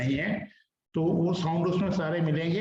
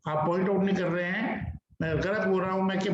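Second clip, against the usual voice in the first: usual speaking voice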